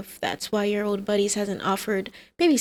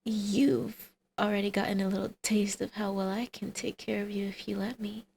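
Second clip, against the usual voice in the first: ordinary delivery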